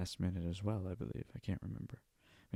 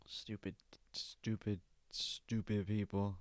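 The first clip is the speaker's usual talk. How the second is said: much softer, slow repeated grumble; muttered disdain; 4 semitones lower, 11 dB quieter, slower than usual. Real, not acted. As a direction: mutter it under your breath in contempt.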